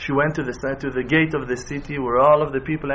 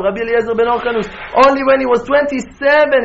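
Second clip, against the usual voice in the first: loud, raised-pitch exhortation; impassioned preaching; 8 semitones higher, 6 dB louder than usual. Real, not acted. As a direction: drive it home with rising fervour. Preaches at full force.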